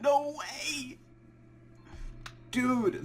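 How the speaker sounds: sudden high cry of disbelief; excited burst. Real, not acted. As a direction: jump up in pitch with joy. Recounting the long hunt.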